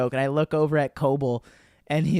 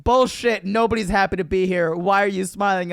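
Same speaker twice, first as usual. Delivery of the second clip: higher, louder mock barking; drill sergeant bark; 5 semitones higher, 6 dB louder than usual. Drill sergeant scolding the recruit.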